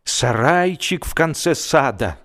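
usual speaking voice

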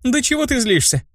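high-pitched mock yell; calling back. A narrator's reading. Brunette running from her friend, high mild yell. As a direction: toss it back in a high girl's voice. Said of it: "кричала она".

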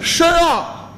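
heavy Beijing drawl on 深奥; mock Beijing accent. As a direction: echo it with a thick Beijing accent. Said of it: "我说话有点北京口音".

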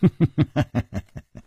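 staccato character chuckle; villain's performed chuckle; acted laugh. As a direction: laugh it out in short bursts. Nangong Ling laughs in the story.

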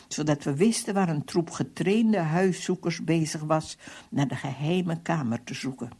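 ordinary delivery

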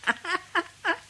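high sly cackle at his expense; gleeful high cackle of triumph. Acted. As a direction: cackle like you fooled him. Aunt laughs after he leaves.

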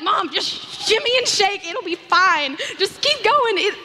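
squeaky encouraging urging; high coaxing. Retold or imitated. Urging mom into tight jeans.

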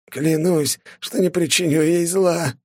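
медленный высокий хрип под пыткой; выхрипеть клятву сквозь боль; хрипя. A narrator's reading. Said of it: "хрипел он". (хрип под пыткой)

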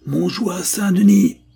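high, loud rallying shout; commanding battle cry. A narrator's reading. Mathieu shouts the knights' rallying cry at the enemy, loud and commanding. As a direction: bellow it to the heavens like a knight. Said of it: "et hurla, d'une voix claire et impérieuse".

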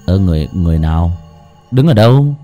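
bewildered. A narrator's reading. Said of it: "Kha ngơ ngác".

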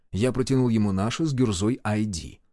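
ordinary delivery

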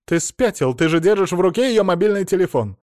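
high, loud indignation; exasperated scolding. A narrator's reading. Mother-in-law points out the phone he holds, exasperated scolding. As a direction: snap at his stupidity.

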